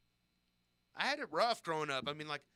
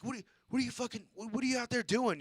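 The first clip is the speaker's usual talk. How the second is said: mock outraged scolding, higher and rushed; accosting teacher; 7 semitones higher, faster than usual. Teacher confronting a kid cutting up worms, accosting. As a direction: imitate a teacher losing it, high-pitched and flustered. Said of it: "he's, like, accosting me about it".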